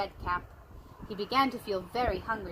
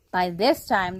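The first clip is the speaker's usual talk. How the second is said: usual speaking voice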